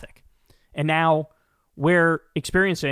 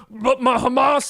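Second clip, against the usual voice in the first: raised, put-on mocking voice; higher, quick-to-ridicule mock protest, drawn out; mocking an idiot; 6 semitones higher, 4 dB louder, slower than usual. Host parroting a video maker he despises, mocking. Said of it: "this fucking idiot is out here doing the".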